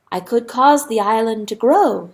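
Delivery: usual speaking voice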